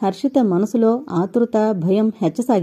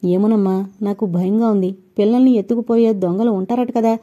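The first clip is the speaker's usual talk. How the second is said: ordinary delivery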